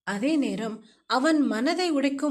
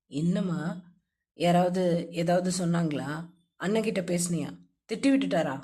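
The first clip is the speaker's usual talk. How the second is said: a hint of a lower, gentle young man's voice; lightly lowered friend's voice, caring; lightly voiced male friend; 8 semitones lower than usual. A narrator's reading. Voices Mukil comforting.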